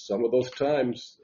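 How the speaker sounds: ordinary talk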